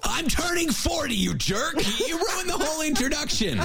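high, laughing mock-indignant yelp; mock outrage. Real, not acted. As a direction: squeal it out in playful fake outrage at your friend.

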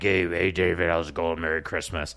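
put-on low, unimpressive speaking voice. Joking about a pop star greeting David, comically low voice. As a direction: drop well down for a mock singer's talking voice. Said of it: "if her actual voice was like".

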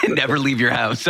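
joking through a laugh; amused, laughing agreement. Real, not acted. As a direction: toss out the joke while laughing. Host laughs along with guest's joke.

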